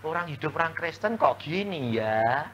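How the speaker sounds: usual speaking voice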